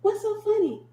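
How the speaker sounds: high, puzzled question; genuinely clueless; confused mom. Retold or imitated.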